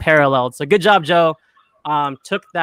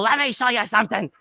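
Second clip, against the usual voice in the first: strained, higher-pitched catchphrase impression; put-on cartoonish voice, pitched up; Fire Marshal Bill; 4 semitones higher, 4 dB quieter than usual. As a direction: do the manic sketch-character catchphrase. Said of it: "Jim Carrey's Fire Marshal Bill".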